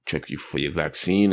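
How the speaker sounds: ordinary talk, nothing marked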